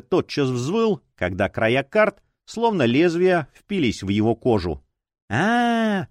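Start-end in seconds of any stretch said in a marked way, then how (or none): 5.3-6.1 high, drawn-out whimpering wail; childlike whimper. A narrator's reading. Fanatic cut by the cards, whimpering like a child. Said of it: "захныкал он, как ребёнок".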